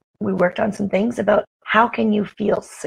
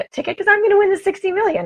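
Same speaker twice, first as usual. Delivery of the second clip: excited; 11 semitones higher, faster than usual. Real, not acted. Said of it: "excited lotto boast".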